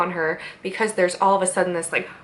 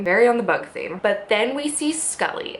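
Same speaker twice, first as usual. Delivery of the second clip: usual speaking voice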